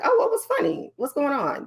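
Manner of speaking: high-pitched, curious mock questions; imitating someone suddenly intrigued; curious listener. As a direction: pipe up as if you missed the joke. Voicing listeners whose attention returns.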